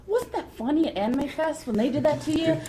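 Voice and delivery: high, blithely cheerful mock question; imitating an oblivious friend; Bob. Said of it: "He goes".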